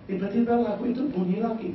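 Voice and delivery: ordinary delivery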